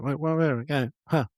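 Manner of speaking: imitated dismissive, stammered brush-off; passive-aggressive. Imitating contestant's interview asides, passive-aggressive. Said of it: "say something kinda like that".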